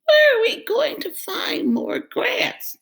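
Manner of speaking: high, worried goat voice; fretful middle goat. Acted. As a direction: sound anxious and small.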